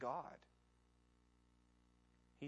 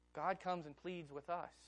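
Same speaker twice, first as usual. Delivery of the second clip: ordinary talk, nothing marked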